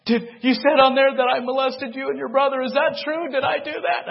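high, loud anguished questions; father begging to know if he did it, high and loud. Retold or imitated. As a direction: ask it in disbelief and pain. Father confronting the truth.

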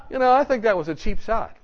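ordinary talk

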